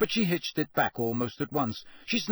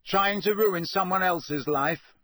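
loudly; 7 semitones higher, slower than usual. Acted. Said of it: "said Harry loudly".

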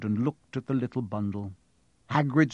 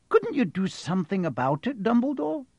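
McGonagall voice; 9 semitones higher than usual. Acted.

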